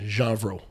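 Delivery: Janvro said with a soft French-style J, as in "zhahn-vro"; corrected pronunciation. Shown as a demonstration. Host corrects co-host on the surname, demonstrating Janvro as "zhahn-vro". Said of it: "No, like, G E N".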